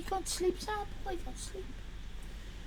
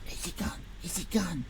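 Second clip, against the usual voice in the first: voiceless whisper; whispered check; 12 semitones lower, 10 dB quieter than usual. Real, not acted. Streamer checking if the viewer has left, whispered. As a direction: hush it like he might still hear.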